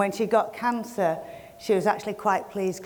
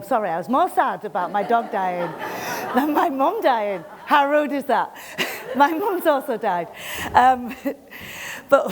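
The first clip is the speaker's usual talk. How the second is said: giggling, higher and louder, between words; laughing through it; 4 semitones higher, 4 dB louder than usual. Real, not acted. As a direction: laugh at your own dark joke. Laughing at own dark joke.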